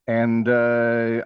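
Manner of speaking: ordinary talk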